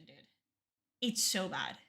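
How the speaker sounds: usual speaking voice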